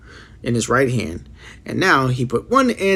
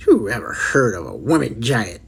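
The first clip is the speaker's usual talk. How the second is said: ordinary delivery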